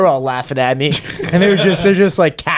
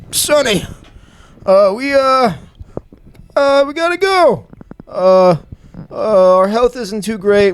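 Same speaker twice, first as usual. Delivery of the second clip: higher, halting grandparent with a catch; 6 semitones higher, slower than usual. Retold or imitated. Grandparents telling Sonny they must move, halting and higher. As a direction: play the hesitant grandparents breaking news. Grandparents break bad news.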